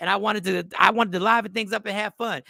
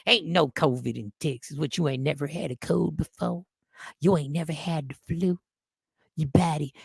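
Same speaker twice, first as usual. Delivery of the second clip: lightly voiced Texan, lower pitched; Texan skeptic; 5 semitones lower, 3 dB quieter than usual. Texan scolding the comic for wearing a mask, lightly mimicked local voice. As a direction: imitate a dismissive Texas local. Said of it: "this is what I was told in Texas".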